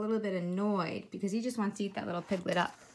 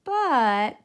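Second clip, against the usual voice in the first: loud; 3 semitones higher, 8 dB louder than usual. A narrator's reading. Piglet interrupts.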